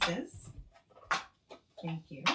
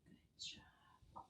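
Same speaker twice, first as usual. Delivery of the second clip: whispered praise; 21 dB quieter than usual. Real, not acted. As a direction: whisper "Good job." softly. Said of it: "praise in whisper".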